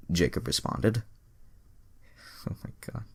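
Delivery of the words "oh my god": a very soft breathy whisper; whispered aside. Real, not acted. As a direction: whisper it in disbelief. Whispered aside while reading.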